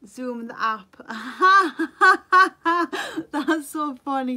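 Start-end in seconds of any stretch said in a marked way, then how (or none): 1.0-3.2 a real loud laugh at the joke. Real, not acted. Amused by a viewer comment. 3.3-4.4 slow, louder words through laughter; laughing while talking. Real, not acted. Still laughing at the comment.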